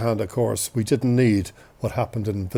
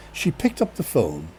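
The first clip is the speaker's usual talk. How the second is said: usual speaking voice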